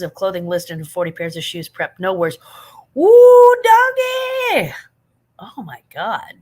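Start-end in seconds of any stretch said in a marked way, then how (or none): 3.0-4.7 very high, loud squeal. Real, not acted. Sees a dog on stream. 5.4-6.3 whispered in awe; awed whisper. Real, not acted. Awed at the dog.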